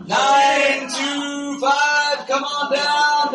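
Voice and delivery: slow, drawn-out number call; booming prize announcer. Real, not acted. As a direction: call it like a game show host. Raffle number called out.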